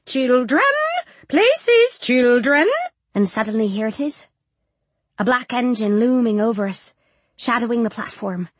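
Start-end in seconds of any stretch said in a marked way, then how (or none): 0.1-2.7 high, reedy sponsor's call with rising ends; reedy matron calling out, pitch leaping up; reedy shout. A narrator's reading. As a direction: call the children to order in a reedy voice. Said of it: "shouts in her reedy voice".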